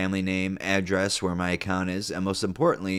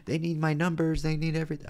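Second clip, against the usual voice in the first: imitated panicked grandma; 9 semitones higher than usual. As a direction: mimic grandma freaking out, high up. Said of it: "She was, like, screaming".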